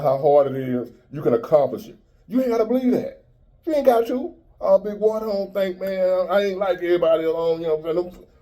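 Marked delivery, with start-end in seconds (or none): 2.3-4.3 much higher, teasing sing-song; mocking high voice. 4.6-8.3 higher, whiny voice of a doubter; mock doubter. Mocking a doubter.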